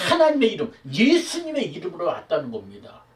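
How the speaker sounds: ordinary talk, nothing marked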